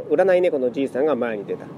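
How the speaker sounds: ordinary delivery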